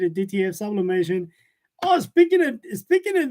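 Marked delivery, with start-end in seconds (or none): 1.8-3.3 surprised. Real, not acted. Sudden recall mid-stream.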